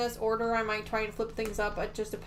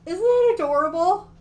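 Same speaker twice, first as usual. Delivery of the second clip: higher and louder, delighted over the block; excited gushing; 7 semitones higher, 10 dB louder than usual. Real, not acted. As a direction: gush over how adorable it is. Gushing over the finished gnome block.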